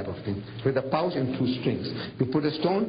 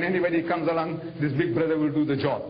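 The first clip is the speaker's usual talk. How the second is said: ordinary talk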